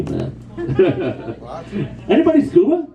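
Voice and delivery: ordinary delivery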